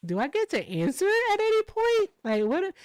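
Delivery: a high, incredulous whine; exasperated. Retold or imitated. On a livestream, waiting for a turn, high and exasperated. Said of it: "And I'm just sitting there like".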